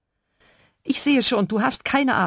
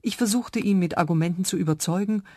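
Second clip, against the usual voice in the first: plain reading voice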